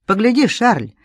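excited, insistent cry; sudden cry. A narrator's reading. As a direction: call out suddenly to make him look. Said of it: "закричала вдруг Жюльетта".

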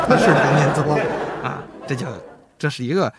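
talking through real laughter; words carried on a laugh. Real, not acted. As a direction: crack up at your own joke while speaking. Laughing after his own punchline.

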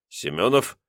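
usual speaking voice

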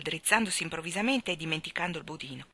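ordinary delivery, nothing marked

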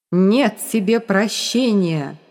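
ordinary delivery

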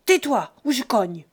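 loud, threatening bark; threatening shout. Acted. Father threatening his son with a blow, loud. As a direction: threaten to hit him.

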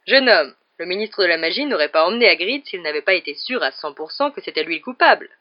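loud, self-assured lecturing; matter-of-fact. A narrator's reading. Lockhart dismisses Dean, loud and self-evident. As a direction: state it as obvious. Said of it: "sur un ton d'évidence".

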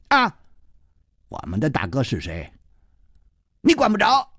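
defiant retort, rising to a high finish. A narrator's reading. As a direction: talk back, refusing to be bossed. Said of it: "第一个不服气".

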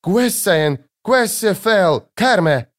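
high anxious outcry; high-pitched frantic exclamations; trembling with agitation. A narrator's reading. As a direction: cry out to children in alarm. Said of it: "трясясь и от волнения переходя на диалект".

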